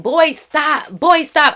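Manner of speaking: calling out sharply; retold yell of boy stop. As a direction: call him off the fight. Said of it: "I'm just yelling".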